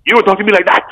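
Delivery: mimicking a clumsy fake Jamaican accent, high and loud; high, loud mock of a failed Jamaican line; bad Jamaican accent imitation. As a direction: send up an actor butchering a Jamaican accent. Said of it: "the guy was like".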